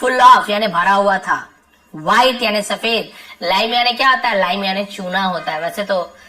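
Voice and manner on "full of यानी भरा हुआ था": normal talking voice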